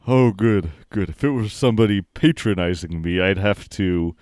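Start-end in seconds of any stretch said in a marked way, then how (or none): none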